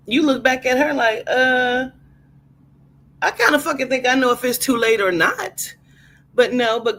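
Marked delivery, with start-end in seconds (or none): 1.3-5.8 annoyed retort. Acted.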